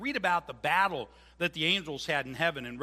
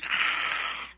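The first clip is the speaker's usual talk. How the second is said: sudden harsh shout; 6 dB louder than usual. Retold or imitated. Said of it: "who's just like".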